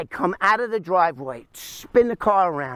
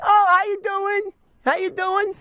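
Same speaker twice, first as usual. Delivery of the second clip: acted friendly greeting; 12 semitones higher than usual. Thief greeting the waving old woman, high mock-friendly greeting. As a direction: put on a high, innocent hello. Acts out his reply to her.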